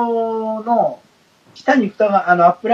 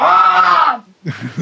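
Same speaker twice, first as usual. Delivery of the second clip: startled shout; 7 semitones higher, 4 dB louder than usual. Retold or imitated. Startled in the retold memory.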